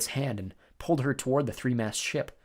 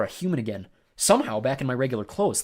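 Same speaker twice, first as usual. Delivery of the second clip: ordinary delivery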